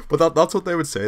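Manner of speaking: usual speaking voice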